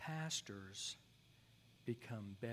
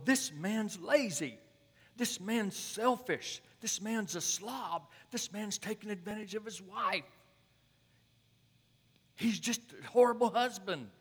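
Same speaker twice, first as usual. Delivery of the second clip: put-on judgmental young pastor; 9 semitones higher, 11 dB louder than usual. Young pastor condemning the husband from the notes, high and loud. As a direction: hand down each charge like a sentence. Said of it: "and he goes".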